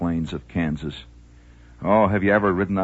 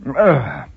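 loud, high grunt of disgust; disgusted ugh; 7 semitones higher, 7 dB louder than usual. Real, not acted. Host grunting in disgust at his hot coffee. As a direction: recoil from a bad taste. Bad coffee and cigar taste.

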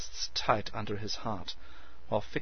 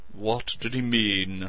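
laborious; 4 dB louder, slower than usual. A narrator's reading. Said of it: "Lance asked laboriously".

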